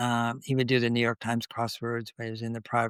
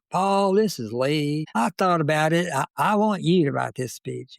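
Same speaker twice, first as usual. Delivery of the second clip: put-on a Bill Clinton-like Arkansas accent, louder and higher; 5 semitones higher, 4 dB louder than usual. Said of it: "in his inimitable Arkansas accent, sounded like Bill Clinton".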